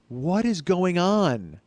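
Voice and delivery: high, drawn-out disbelief; baffled exclamation. Retold or imitated. Dealer shrugging at the customer's broken seat, baffled and drawn out. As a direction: draw it way out, utterly baffled.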